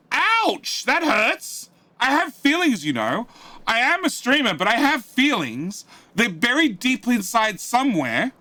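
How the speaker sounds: high, hammy wounded whine; mock hurt. Joking hurt reply to chat.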